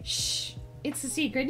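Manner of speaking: a hushed mock secret; hushed secrecy. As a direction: shush them and confide it quietly. Shushes chat about a secret.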